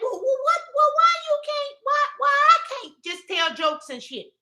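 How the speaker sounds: high-pitched mock protest to God; whining complaint. Speaker complaining to the Lord about serious work, mock whine. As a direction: plead and whine in comic exasperation. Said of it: "that's why I be telling the Lord".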